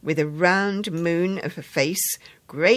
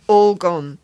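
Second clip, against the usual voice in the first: "All gone" dropped flat and louder, falling at the end; blunt; 3 semitones higher, 9 dB louder than usual. A narrator's reading. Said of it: "he replied bluntly".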